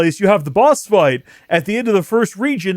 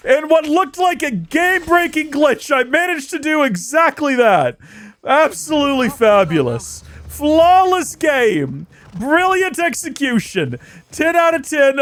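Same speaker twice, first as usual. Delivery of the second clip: mock review gush; 9 semitones higher than usual. Mock review praise after the glitch.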